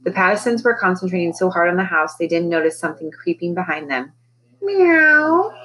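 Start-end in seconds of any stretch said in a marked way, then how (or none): 4.6-5.5 high, loud, drawn-out meow; long plaintive cat cry; cat's meow. A narrator's reading. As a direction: call out like a cat. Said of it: "it called".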